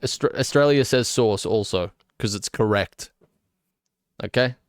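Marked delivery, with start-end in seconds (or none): none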